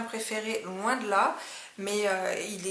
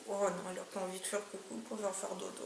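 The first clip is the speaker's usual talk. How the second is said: whispering over a crib; 9 dB quieter than usual. Real, not acted. Talking softly to sleepy dog.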